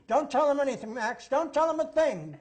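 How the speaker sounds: high, loud cry down the phone; acted frantic shout; urgent shouted warning. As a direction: imitate a captive chief yelling a warning. Imitating captured chief yelling into phone.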